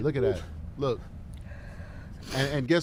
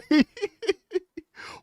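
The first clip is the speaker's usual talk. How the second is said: big laugh; 14 semitones higher than usual. Real, not acted. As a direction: break into a real, high laugh. Host cracks up at himself.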